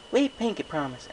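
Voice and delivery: high little-sister voice, coaxing; a small filly asking big sis a favour; young filly. A narrator's reading. Fillies promise.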